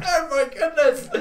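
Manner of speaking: high, loud startled cry with a laugh; mock startled cry. Gamer startled by a creature, mock loud yelp. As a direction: yelp it like a jump scare got you. Imagined game player startled.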